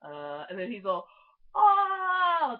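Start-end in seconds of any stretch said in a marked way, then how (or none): 1.6-2.6 loud, high drawn-out oh; sudden realization. Retold or imitated. Imitating the actor as he finally gets it, exaggerated realization. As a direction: blurt it as the penny finally drops.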